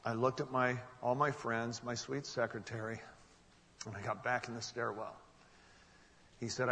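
ordinary delivery

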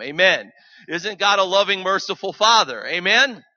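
loud, high Amen call to the room; preaching loudly. Real, not acted. Preacher calling the church to agree, loudly. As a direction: rouse the congregation.

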